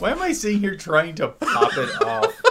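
words riding on laughter; laughing at oneself. Real, not acted. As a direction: laugh through your baffled question. Laughing at own failed attempt.

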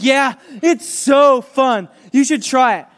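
put-on a boy gushing about his fun; giddy kid. Teacher voices Darius, drawn-out and giddy.

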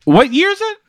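high, loud, laughing disbelief; incredulous. Acted. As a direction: demand it in baffled, high-pitched amusement. Baffled by being given flip phone.

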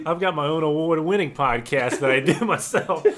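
loud, laughing braggart voice; laughing boast. Pretend. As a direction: brag it while cracking up.

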